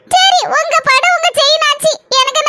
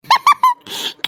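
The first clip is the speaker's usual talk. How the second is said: scornful high laugh; mocking laugh; 9 semitones higher than usual. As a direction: burst out laughing at her. Sister-in-law mocks the chain.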